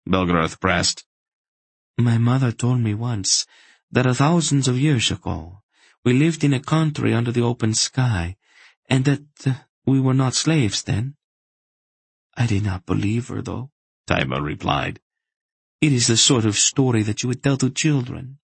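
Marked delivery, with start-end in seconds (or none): 2.0-13.7 higher-pitched slave woman, recounting a memory. Acted. 15.8-18.4 higher-pitched slave woman, recounting a memory. Acted.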